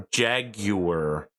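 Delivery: "Jaguar" drawn out slowly as JAG-you-er; exaggerated pronunciation. Shown as a demonstration. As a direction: stretch "Jaguar" into three deliberate syllables. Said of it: "that's not how I pronounce it".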